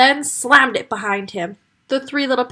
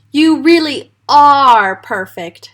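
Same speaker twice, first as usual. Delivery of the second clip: slow, louder, gushing; swooning admiration; 3 semitones higher, 8 dB louder, slower than usual. Acted. Mummy Pig gushing to the wolf, smitten. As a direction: gush over the guest.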